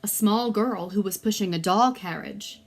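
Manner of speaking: ordinary delivery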